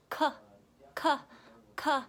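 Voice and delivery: "K" said as short "kuh"; hard phonic "K", kuh, spaced out; letter sound. Shown as a demonstration. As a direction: give "K" as separate kuh sounds.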